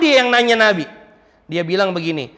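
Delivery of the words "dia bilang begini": usual speaking voice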